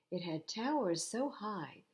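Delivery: plain reading voice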